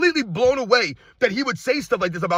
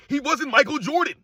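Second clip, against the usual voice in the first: ordinary talk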